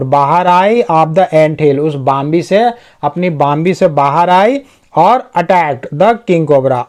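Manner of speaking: ordinary delivery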